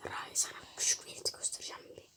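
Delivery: fast hushed whisper; stage whisper. Real, not acted. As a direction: whisper it like a secret plan. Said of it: "Ben neden fısıldayarak konuşuyorum?". (fısıltıyla konuşma)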